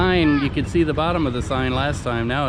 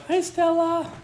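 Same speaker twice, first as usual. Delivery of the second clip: a much higher sing-song pet voice; high greeting to dog; slower than usual. Real, not acted. Owner greeting her dog, very high.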